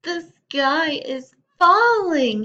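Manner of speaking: high, loud, slow panicky cry; panicked chick. Acted. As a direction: wail it like a frightened chick. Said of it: "he thought".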